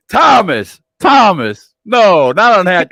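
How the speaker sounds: loud mock warning; mock warning call-out. Real, not acted. Host scolding a chat commenter in jest, loud. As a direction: call the chatter out, half joking.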